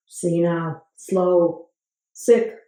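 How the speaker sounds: normal talking voice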